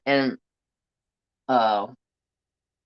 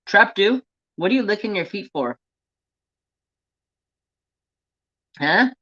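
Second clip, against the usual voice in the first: pet talk; 8 semitones higher, 3 dB louder than usual. Real, not acted. Talking to the pet.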